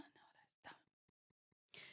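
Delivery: voiceless stunned whisper; whispering done to the lady. Retold or imitated. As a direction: breathe it out in disbelief. Retold stunned reply.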